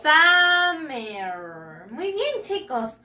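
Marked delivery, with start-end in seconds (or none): 0.0-1.9 "Summer" stretched loud into SAAH-merr; pronunciation model. Shown as a demonstration. Teacher has pupils repeat after her, "Summer" as slow SAAH-merr. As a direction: draw out both syllables of "Summer", first an open ah.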